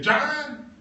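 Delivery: high loud call of the name. Retold or imitated. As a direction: call John over from across the field. Boss calling John.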